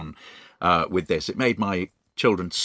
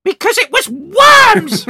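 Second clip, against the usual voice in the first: much higher, much louder, slow gleeful shriek; Missus Twit's triumphant cry; 12 dB louder, slower than usual. Acted. Missus Twit revealing the worms to her husband, triumphant shriek. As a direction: shriek it with wicked delight. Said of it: "cried Missus Twit".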